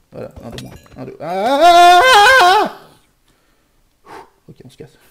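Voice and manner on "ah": very high, loud shriek; a sudden jolt of fright; scream. Real, not acted. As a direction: gamer shrieking at a sudden attack. Sudden attack.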